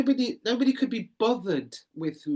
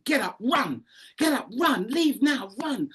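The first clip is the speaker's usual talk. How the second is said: higher urgent voice telling her to run; an anxious whisper of flight turned urgent, higher pitched; panicked inner voice; 6 semitones higher, 4 dB louder than usual. Retold or imitated. A voice in her head urging her to flee, higher and urgent. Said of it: "The other one said".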